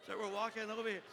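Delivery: ordinary delivery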